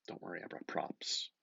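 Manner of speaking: whispered aside to the audience; conspiratorial whisper. Real, not acted.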